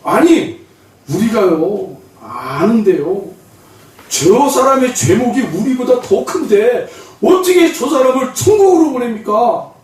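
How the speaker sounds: hammed-up, drawn-out objection; protesting. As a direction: raise your pitch in mock indignation. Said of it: "세 사람이 반기를 들었어요". (억울한 듯 길게 따지는 말투)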